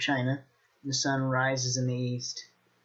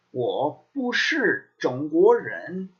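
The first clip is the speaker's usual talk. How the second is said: 我不是中国人 said very slowly, syllable by syllable; slow model sentence; 4 dB louder, much slower than usual. Shown as a demonstration. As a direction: spell the sentence out for learners. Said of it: "teacher models sentence slowly".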